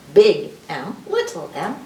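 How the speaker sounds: ordinary talk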